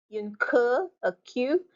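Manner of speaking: ordinary delivery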